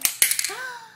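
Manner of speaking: surprised gasp. Real, not acted. Mother reacts to picture.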